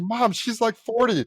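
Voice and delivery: high-pitched objection to mom; retold mortified protest. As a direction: act out an embarrassed son objecting. Son protests mom's matchmaking.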